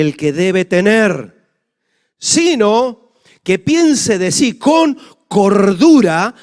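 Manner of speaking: loud, slow, proclaiming sermon voice; fervent scripture reading. Acted. Pastor reading Romans aloud to the congregation, fervent and loud. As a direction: proclaim the verse with full force. Reads scripture then preaches loudly.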